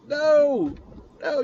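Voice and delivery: exasperated no. Retold or imitated. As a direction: cry out in disbelief. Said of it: "you're sitting there going".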